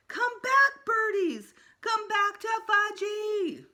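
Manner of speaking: put-on very high, loud toddler shriek; screaming. A narrator's reading. Little brother screaming after pigeons, high and loud. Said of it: "was screaming".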